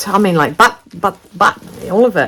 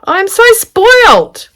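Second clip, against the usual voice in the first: delighted gasp; 10 dB louder, slower than usual. Real, not acted. Overwhelmed by the gift.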